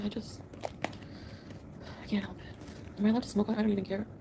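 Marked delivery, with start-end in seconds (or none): 0.0-3.0 slow, soft whisper. Real, not acted. Streamer confessing she smokes when stressed, whispering. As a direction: barely breathe the words out. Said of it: "I might cry".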